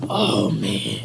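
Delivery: low, hushed groan of dismay; retold shock, sinking low and soft; stunned dismay. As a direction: act out quietly realizing you're hurt. Acts dismay on seeing the cut.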